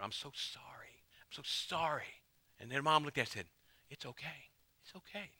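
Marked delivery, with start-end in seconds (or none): 0.0-2.2 hushed, slow whispered I'm so sorry; a whispered, tearful daughter's apology. Retold or imitated. 3.9-5.3 very soft, gentle it's okay; mother's soft, gentle reassurance; tender reassurance. Retold or imitated. Mom comforting daughter.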